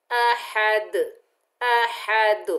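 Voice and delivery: अहद with a bouncing echo on the final द; qalqala on dal. Shown as a demonstration. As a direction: stop on अहद and let the द rebound. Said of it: "दाल की double sound आएगी".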